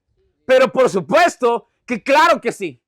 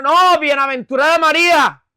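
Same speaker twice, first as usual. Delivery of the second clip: loud, high gushing cry; hammed-up amazement; outburst of emotional praise; 5 semitones higher, 6 dB louder than usual. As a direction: exclaim as an overawed believer. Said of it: "aquí se desata una expresión".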